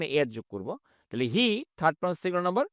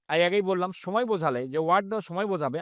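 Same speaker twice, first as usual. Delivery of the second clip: ordinary talk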